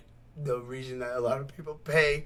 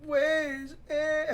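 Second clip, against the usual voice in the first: high-pitched, failed Scottish accent; badly faked Scots, pitched way up; attempted Scottish accent; 10 semitones higher, much slower than usual. Shown as a demonstration. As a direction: have a clumsy go at a Scottish accent. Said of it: "I can't do it".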